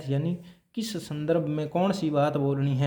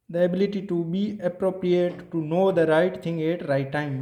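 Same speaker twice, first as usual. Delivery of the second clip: slow dictation; 2 semitones higher, much slower than usual. Shown as a demonstration. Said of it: "teacher dictating a principle".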